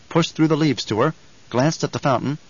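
usual speaking voice

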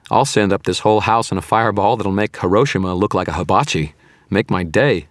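upbeat, goading taunt; cheerful. A narrator's reading. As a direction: dare him with cheerful recklessness. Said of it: "I said in a cheerful tone".

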